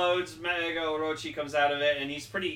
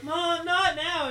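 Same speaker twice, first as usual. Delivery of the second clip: much higher, slow, drawn-out whine; exasperated teen; 14 semitones higher, 5 dB louder, much slower than usual. Acted. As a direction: whine it slowly at mum. Daughter brushing off mother.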